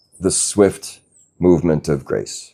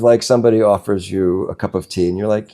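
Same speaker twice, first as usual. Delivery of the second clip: ordinary talk, nothing marked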